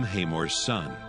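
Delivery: usual speaking voice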